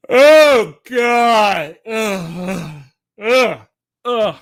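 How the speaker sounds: groaning through a big yawn. Real, not acted. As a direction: let the words stretch into a yawn. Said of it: "the yawning is absolutely ridiculous".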